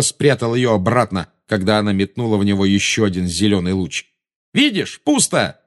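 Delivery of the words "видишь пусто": high, drawn-out defiant cry; shouting. A narrator's reading. Boy shows the witch his empty hand, crying out. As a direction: cry it out in triumph. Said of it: "крикнул он".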